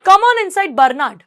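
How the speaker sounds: high, loud call-in, dropping on the name; hearty welcome. Acted. Old lady inviting Bernard inside, loud hearty welcome. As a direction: usher the visitor in emphatically. Story woman welcomes boy in.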